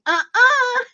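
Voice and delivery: squeaky high "uh-uh"; imitating the boy refusing the homemade dish; little kid refusing. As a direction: refuse it like a stubborn small boy. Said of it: "I said".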